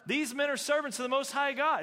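high, loud imitated proclamation; proclaiming. Fortune-telling girl trailing the apostles everywhere, loud mock proclaiming. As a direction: call it out like a street announcer. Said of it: "She's following these guys proclaiming".

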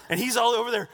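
ordinary delivery

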